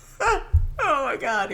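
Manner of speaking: high, breathless laughter through the words; can barely get it out for laughing. Real, not acted. Telling the landing story, laughing.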